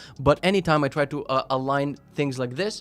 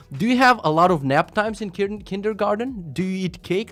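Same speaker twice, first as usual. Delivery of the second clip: mocking scolding; 7 semitones higher than usual. Real, not acted. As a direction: talk down to the link sender like a preschooler. Mocking the porn-link sender.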